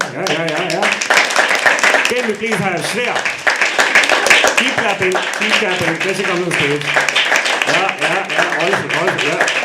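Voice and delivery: loud, high stage call; hyping the crowd. Real, not acted. Host welcoming the next performer on stage, loud hyped-up call. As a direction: whip up applause for the next act.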